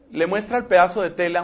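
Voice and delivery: usual speaking voice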